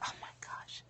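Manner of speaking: breathless whispered oh my gosh; a hushed gasp of dismay; stunned whisper. Real, not acted. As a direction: whisper it in disbelief. Stunned watching other soloists sing.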